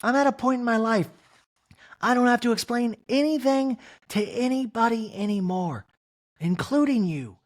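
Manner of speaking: mock high defiant wife. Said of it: "Kyle goes".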